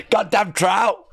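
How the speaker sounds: loud, high, laughing outburst; laughing exclamation. Real, not acted. Cracking up at the story, laughing shout. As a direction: blurt it out mid-laugh.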